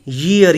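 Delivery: slow ईयर with a clear y onset; pronunciation demo. Shown as a demonstration. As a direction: stretch ईयर out as "yeer". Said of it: "इसको बोलते हैं ईयर". (ईयर को धीरे, 'य' से शुरू करके)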